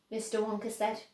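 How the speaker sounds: ordinary delivery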